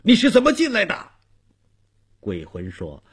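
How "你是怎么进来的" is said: high, startled challenge to an intruder; startled questioning. Acted. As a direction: demand answers in alarm, pitched up. Old man confronts sudden ghost. (受惊地高声质问)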